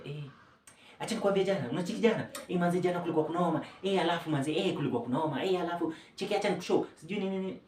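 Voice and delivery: high, fast, breathless babbling about last night; racing manic chatter. Retold or imitated. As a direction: rattle it off like a manic friend who can't stop. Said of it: "Mtu anaongea ni kama ana rap".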